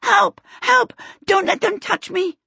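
high-pitched panicked shriek. A narrator's reading. Twinkle fleeing upstairs from the Lubberkins, shrieking. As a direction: shriek for help, voice leaping up.

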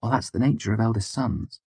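matter-of-fact. A narrator's reading. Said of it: "Denner said matter-of-factly".

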